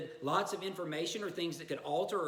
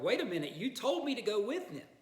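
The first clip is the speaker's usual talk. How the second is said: indignant protest; 7 semitones higher than usual. Retold or imitated. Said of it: "it's kind of like".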